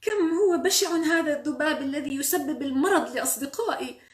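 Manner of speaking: loud, high character complaint; indignant storybook voice; disgusted self-talk. Acted. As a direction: exclaim to yourself in revulsion. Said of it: "حدث العم نظيف نفسه".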